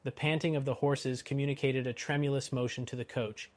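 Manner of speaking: ordinary delivery, nothing marked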